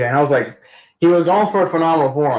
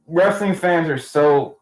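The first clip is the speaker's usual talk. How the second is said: normal talking voice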